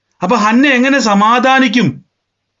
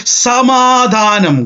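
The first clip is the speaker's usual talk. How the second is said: very slow, higher, firm word; calm declaration; 4 semitones higher, much slower than usual. Retold or imitated. Hannah answers the mockers, slow and ringing. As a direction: let the single word ring out slowly. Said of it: "Hannah പറയുക".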